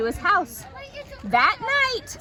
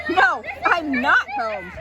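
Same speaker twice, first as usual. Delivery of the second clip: sad; 9 semitones higher, 4 dB louder, slower than usual. A narrator's reading. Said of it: "Pooh said sadly".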